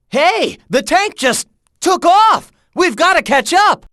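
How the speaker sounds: high-pitched, urgent shout; rally a teammate to give chase; alarmed call. Acted. Tank escapes, rallying the chase.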